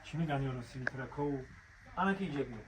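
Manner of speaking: ordinary talk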